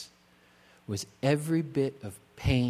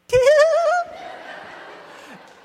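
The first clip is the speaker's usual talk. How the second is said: a much higher, loud, nervous giggle; imitated giggle; 11 dB louder than usual. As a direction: mimic the bride's giggle. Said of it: "whose nervous reaction was to giggle".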